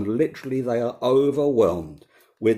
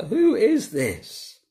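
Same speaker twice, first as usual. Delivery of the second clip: high, drawn-out astonished question; retold awed fear; 6 semitones higher, much slower than usual. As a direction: ask it slowly in mock alarm, pitched up. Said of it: "They were terrified and asked each other".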